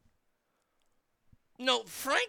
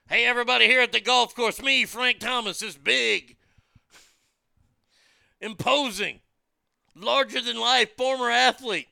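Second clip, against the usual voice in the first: mock announcement; 6 dB louder than usual. Mock announcement to golf course.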